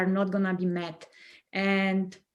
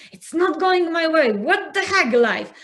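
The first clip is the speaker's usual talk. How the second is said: higher and louder, mock-frustrated rant at life; venting frustration, hammed up a little; exasperated outburst; 8 semitones higher, 6 dB louder than usual. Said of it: "I'm getting upset".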